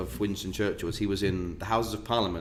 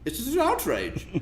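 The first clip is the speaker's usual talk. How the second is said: high mock outrage; hammed-up scandalised lady; lady denouncing him, high and mock-indignant; 13 semitones higher than usual. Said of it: "from some lady".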